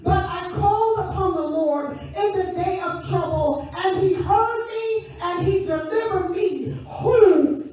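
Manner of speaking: loud, sung-out preacher cadence; preaching at full force. Acted. Preacher rousing the congregation, loud sung-out cadence. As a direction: lift the church with rising, chanted preaching.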